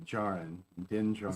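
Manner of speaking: "Djarin" said with the D silent. Shown as a demonstration. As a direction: start "Djarin" on the J sound, no D. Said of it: "it's with a silent D".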